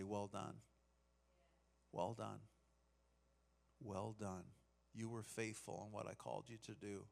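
soft, tender well done; gentle Jesus voice. Retold or imitated. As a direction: speak it softly like a loving master. Jesus voiced greeting the faithful.

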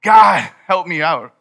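loud cry out, stretched slowly; crying out to God. Acted. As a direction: call out desperately for help. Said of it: "you gotta cry out".

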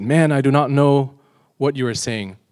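loud, acted protest; insistent denial. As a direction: deny it forcefully. Peter's last denial, acted.

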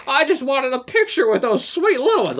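high, plaintive comic-actor impression; hammed-up, high-pitched disappointment; Don Knotts. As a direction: plead like a wounded old TV comedian. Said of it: "I am doing a Don Knotts impression now".

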